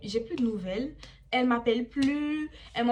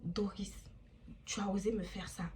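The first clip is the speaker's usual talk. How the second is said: hurt whisper; 3 semitones lower, 10 dB quieter than usual. Retold or imitated. Friend accusing her, quoted.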